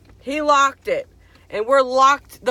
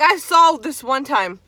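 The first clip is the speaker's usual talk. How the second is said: usual speaking voice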